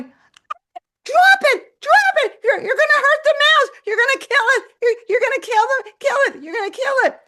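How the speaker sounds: very high, loud panicked shriek; frantic screaming. Retold or imitated. Owner begging her cat to drop the mouse, frantic screaming. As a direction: scream it as an urgent plea.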